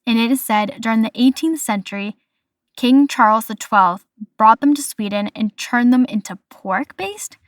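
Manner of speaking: ordinary delivery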